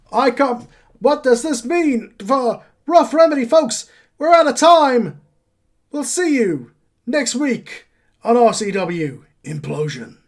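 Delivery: loud, high-pitched frantic sign-off; wrestling commentator. Acted. Commentator closes the show as a brawl erupts, excited shout. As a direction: call it like a shocked ringside commentator. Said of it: "and on the commentary".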